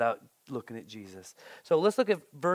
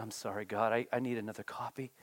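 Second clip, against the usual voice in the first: sheepish apology; 7 semitones lower, 10 dB quieter, faster than usual. Retold or imitated.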